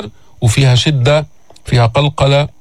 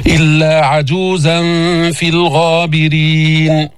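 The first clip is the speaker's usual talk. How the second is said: slow chanted verse; Quran recitation; slower than usual. Shown as a demonstration. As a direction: draw out the end. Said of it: "teacher recites verse".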